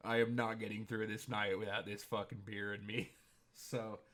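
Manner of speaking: ordinary talk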